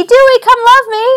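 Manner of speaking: high, coaxing call to the puppy; calling the dog. Real, not acted. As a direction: call him over in a high pet voice. Calling her dog over.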